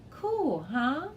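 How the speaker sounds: high, pleased exclaim; delighted at the see-through frog; excited. A narrator's reading. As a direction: show off the frog.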